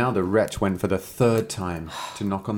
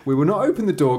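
put-on high, defiant kid goats; high-pitched children's chorus; little kids crying out; 6 semitones higher, 4 dB louder, faster than usual. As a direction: pipe up like a little kid refusing. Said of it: "cried they".

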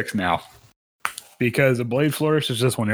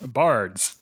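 ordinary delivery

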